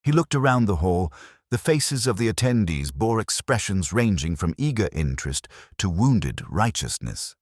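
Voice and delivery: ordinary delivery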